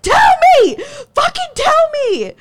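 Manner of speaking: very high, loud shriek; full-force acted yelling; screamed demand. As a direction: scream for an answer from someone silent. Acts out screaming demand at silence.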